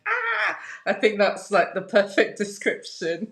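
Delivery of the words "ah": high, frantic "Ah!" of a paddling duck; frantic duck squawk. Retold or imitated. Guest imitates a duck's frantic legs with a high squeal. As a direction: squeal the panicked legs under the water. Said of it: "their legs are going like".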